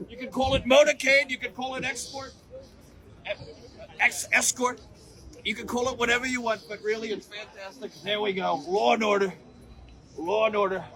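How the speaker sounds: rally-stage Trump, self-congratulating; Trump impression. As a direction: brag to the crowd like a showman president. Trump show, Mister Trump.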